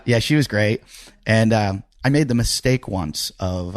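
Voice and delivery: ordinary delivery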